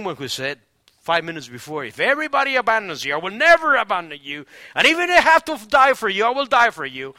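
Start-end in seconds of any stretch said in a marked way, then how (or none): 1.8-7.1 high, loud pledge of loyalty; emphatic vow. Retold or imitated. Peter swearing to Jesus he will never abandon him, emphatic vow. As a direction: declare it boldly as a promise.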